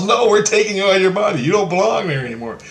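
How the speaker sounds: high, emphatic imitation; insistent retold order. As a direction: push it out high and urgent. Acting the figure's insistent order.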